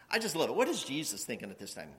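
ordinary delivery

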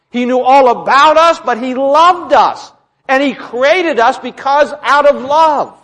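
slow, weighty key words; impassioned preaching. Real, not acted. Preacher declaring God's love to congregation, impassioned.

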